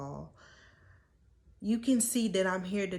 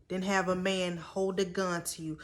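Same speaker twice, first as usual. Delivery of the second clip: ordinary talk, nothing marked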